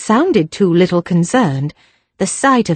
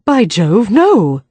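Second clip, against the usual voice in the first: indignant "By Jove, no!" with a touch of outrage; outraged cry; slower than usual. A narrator's reading. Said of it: "cried Philip, outraged".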